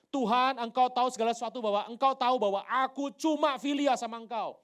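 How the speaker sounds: loud, high confession; impassioned plea. Acted. Peter answers the Lord's question, pleading loudly. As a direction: pour it out at full volume.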